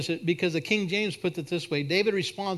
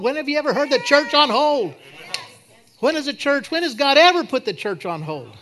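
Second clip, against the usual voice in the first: high, loud rhetorical challenge; indignant preaching; 7 semitones higher, 5 dB louder than usual. Real, not acted. Preacher challenging a church put on hold, indignant preaching. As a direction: throw the question out hard at the congregation.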